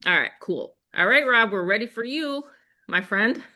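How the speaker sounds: usual speaking voice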